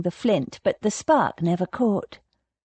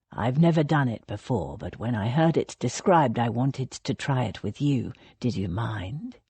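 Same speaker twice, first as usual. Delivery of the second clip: lower, softer man's voice, tentative; Thomas's voice; 8 semitones lower, 3 dB quieter than usual. A narrator's reading. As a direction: ask her gently, unsure.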